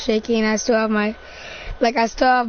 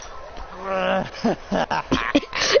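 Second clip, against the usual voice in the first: softer insane chuckle, rising; mad chuckling; 3 semitones lower, 5 dB quieter than usual. Acted. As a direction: chuckle softly, out of your mind. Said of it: "You can't just laugh".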